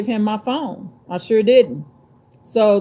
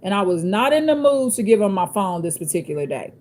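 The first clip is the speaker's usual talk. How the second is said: ordinary talk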